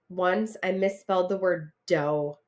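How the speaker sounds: ordinary delivery, nothing marked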